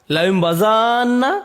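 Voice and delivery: লায়ুম বাজান্না slowly, the last a of বাজান্না stretched; lengthened reading. Shown as a demonstration. Teacher giving the second reading, stretched and slow. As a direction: draw out বাজান্না and let it fall. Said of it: "এর তিনটি কেরাত আছে".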